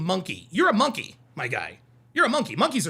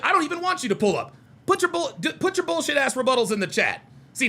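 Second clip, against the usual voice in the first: ordinary talk, nothing marked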